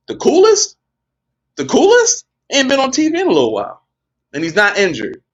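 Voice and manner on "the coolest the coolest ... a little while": teasing excited hint. Real, not acted. Teasing hint about a wrestler.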